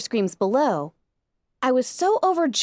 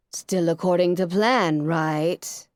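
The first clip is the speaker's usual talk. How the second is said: dry, sarcastic lower voice; 6 semitones lower, slower than usual. Acted. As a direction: drip with sarcasm. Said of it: "With a clear note of sarcasm".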